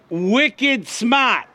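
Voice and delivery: "Wicked smart" in a light Boston accent, the r in smart dropped. Shown as a demonstration. Preacher teaching the congregation Boston lingo, light Boston accent. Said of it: "You say".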